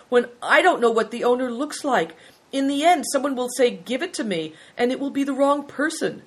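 high, loud, puzzled protest; perplexed. A narrator's reading. Boy doubts he can find the owner, perplexed. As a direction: worry it through, pitch held high.

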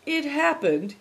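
high-pitched, drawn-out start; lightly acted drawl. A narrator's reading. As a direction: drag out the words reluctantly. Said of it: "he drawled".

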